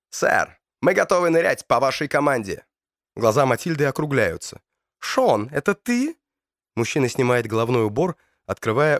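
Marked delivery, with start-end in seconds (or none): 0.1-2.6 put-on light British accent in Russian. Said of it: "говорит с британским акцентом". 5.0-6.2 high, astonished question; surprised. Acted. Matilda surprised to recognise Sean.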